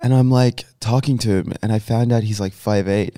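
ordinary talk, nothing marked

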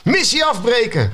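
high, drawn-out alarm cry; Gozert's mock yell. A narrator's reading. Gozert calling off the troll attack, mock yell. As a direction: yell it high and slow, without full volume. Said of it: "gilde Gozert".

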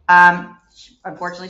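ordinary talk

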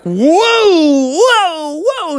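long, high swooping whoas. Acted. Riders swung down the fast track, high whoas. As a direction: cry out high as the car swings.

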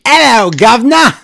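loud, high-pitched mock British shout; bad British accent. Podcast host greeting his co-host, hammed-up British accent. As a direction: blast it out in a hammy British accent. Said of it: "blew everybody's ears out with my bad accent".

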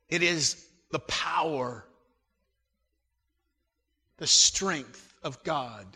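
measured prayer with long pauses; unhurried pastoral prayer, fading at the end. Acted. Leads a prayer.

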